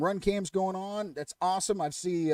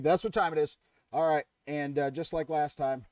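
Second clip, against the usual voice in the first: ordinary talk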